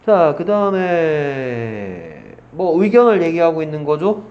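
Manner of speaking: ordinary talk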